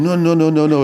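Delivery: high, loud string of mock refusals; retold refusal. Chef refusing cream in mashed potato, retold emphatic refusal. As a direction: imitate someone firmly vetoing an idea.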